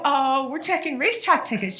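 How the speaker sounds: high, bright innocent answer; innocent kid voice. Retold or imitated. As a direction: play the caught kid, all innocence.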